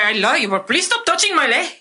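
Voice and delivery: grandma's voice. Retold or imitated. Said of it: "I do my grandma's voice".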